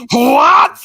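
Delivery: high, loud sharp shout; yelled what. Retold or imitated. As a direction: snap it out like she just heard something. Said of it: "And then it's".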